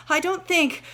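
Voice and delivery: panting, high and loud; boy escaping a lion, panting; out of breath. Acted. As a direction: gasp it out after running. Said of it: "between gasps of air".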